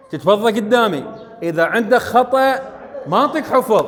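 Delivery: usual speaking voice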